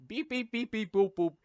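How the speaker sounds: high beeping noises; robot beeps. Acted. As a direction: beep like a machine. Imitating machine beeps.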